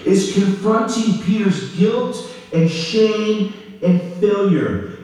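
ordinary delivery

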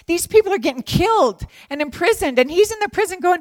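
loud, high, urgent explanation; fervent, worked-up insistence; impassioned preaching. Real, not acted. Worked up preaching about persecuted believers.

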